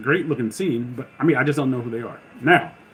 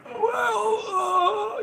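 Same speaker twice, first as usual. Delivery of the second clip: squeaky high voice; slower than usual. Pretend. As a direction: voice cracking up high. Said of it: "I'm like".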